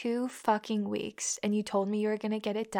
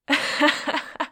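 mocking laugh; 4 semitones higher, 4 dB louder than usual. Scornful laugh at him.

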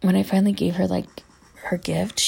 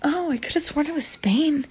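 mimicking clueless mom; 9 semitones higher than usual. Mom's voice, clueless.

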